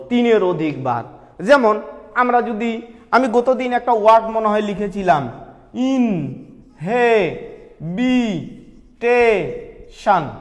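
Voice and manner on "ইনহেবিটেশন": ইনহেবিটেশন very slowly, in-he-bi-te-shan, a gap after each; broken into syllables. Shown as a demonstration. Teacher counting syllables for students, ইনহেবিটেশন chopped apart very slowly. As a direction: break ইনহেবিটেশন into five long, separate syllables. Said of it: "ভেঙে ভেঙে উচ্চারণের সুবিধার জন্য".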